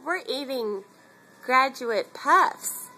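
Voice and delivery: ordinary talk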